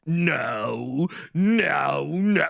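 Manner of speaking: long drawn-out theatrical "no"; hammed-up Sith lord wail; Palpatine. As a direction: imitate a movie villain's low, stretched cry. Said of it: "Revenge of the Sith where he was like".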